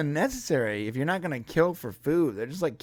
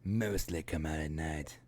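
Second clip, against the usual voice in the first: low, soft imitation of an accent; Newt's accent; 10 semitones lower, 8 dB quieter than usual. Echoing co-host's Newt line, low put-on accent. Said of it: "had this accent like this".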